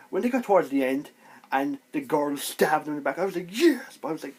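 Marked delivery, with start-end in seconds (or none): 3.5-4.0 loud, high burst of triumph; retold cheer. Fan reliving the girl's stab in the back, loud high cheer.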